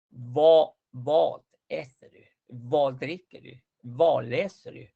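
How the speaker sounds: each "vad" with a rounded O, like "vod"; slow model phrases, "vad" held on O; long O. Shown as a demonstration. Teacher drilling questions for students, "vad" with long O.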